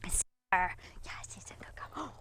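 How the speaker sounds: hushed, voiceless dog praise; whispered praise. Real, not acted. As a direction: whisper your approval to the dog. Praising the dog while training.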